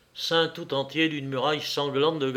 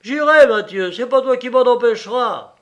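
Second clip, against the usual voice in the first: high, loud cry of defiance; raised, stubborn yell; defiant shout; 9 semitones higher, 10 dB louder than usual. A narrator's reading. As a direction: shout back that nobody stops you. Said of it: "Jean se mit à crier".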